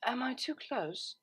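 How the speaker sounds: soft, slow, hushed and nervous; barely-there nervous whisper. A narrator's reading. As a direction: ask it in a small nervous whisper. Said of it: "cleared her throat nervously and whispered".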